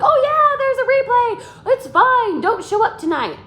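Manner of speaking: high, loud, laughing sarcastic brush-off; mock the lazy follow-up with a laugh; mock lazy message.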